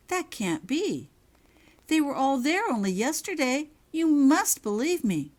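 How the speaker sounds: begging to be believed; distraught protest. Acted. Girl insists to professor the dorms stood yesterday, desperate.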